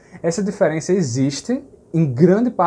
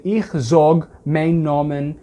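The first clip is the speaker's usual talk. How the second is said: slow, every word set apart; teacher saying the Yiddish sentence slowly; slow Yiddish example; much slower than usual. Shown as a demonstration. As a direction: model the Yiddish phrase. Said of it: "Vou falar Yiddish".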